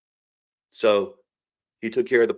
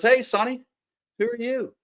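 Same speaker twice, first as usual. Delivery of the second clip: much higher, lightly voiced old patient; patient calling out; 13 semitones higher than usual. Injured patient greeting a small boy, high and friendly. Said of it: "The guy looks up and goes".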